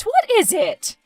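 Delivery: high, tearful whine; whimpering protest; whiny cry. Acted. Upset reply to mother.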